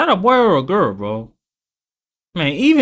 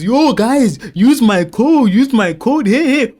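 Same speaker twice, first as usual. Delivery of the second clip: higher, louder sponsor-plug imitation; mock ad pitch; 7 semitones higher, 4 dB louder, slower than usual. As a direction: hype the promo code in mockery. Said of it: "putting ads in people's faces".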